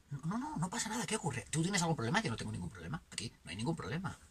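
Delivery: soft, hushed brush-off; dismissive denial. Acted. Someone insisting nothing is wrong, softly dismissive. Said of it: "esa manía de que no, no pasa nada".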